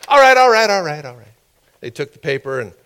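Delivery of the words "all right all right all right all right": loud high burst, fading fast; annoyed cutting-in. Retold or imitated. Officials stopping the man's fluent reading, mock annoyed interruption. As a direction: cut the reader off, then tail away.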